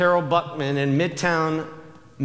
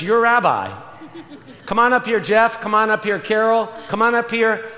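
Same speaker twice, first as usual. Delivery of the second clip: raised, higher-pitched call to the front; 5 semitones higher, 3 dB louder than usual. Real, not acted. Host calling rabbi and artist to the front, calling up. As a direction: call them up over the crowd. Calling people up before congregation.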